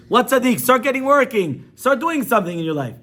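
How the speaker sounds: loud, high-pitched telling-off; scolding wife. Retold or imitated. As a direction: scold a lazy husband loudly. Wife scolds idle husband.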